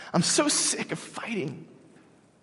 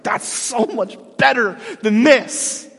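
higher, louder, slow and emphatic; emphatic Paul; 8 semitones higher, 5 dB louder, slower than usual. Retold or imitated. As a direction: lean into it like Paul sure of heaven. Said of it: "He's like".

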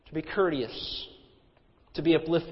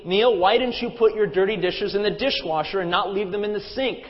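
imitating a wife's sarcastic nagging; sarcastic call; 4 semitones higher, 5 dB louder than usual. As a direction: call out a raised, pointed complaint. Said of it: "call out sarcastically".

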